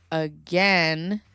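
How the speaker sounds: ordinary delivery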